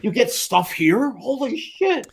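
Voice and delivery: high-pitched, awestruck reenactment; mock amazement. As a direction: act out wide-eyed disbelief at the perks. Acts out amazed first suite visit.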